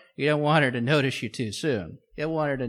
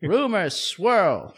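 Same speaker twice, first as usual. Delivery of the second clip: put-on old-timey newsreel voice; 5 semitones higher, 6 dB louder than usual. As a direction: announce it like a 1940s newsreel. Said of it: "Are you an old-timey voice?".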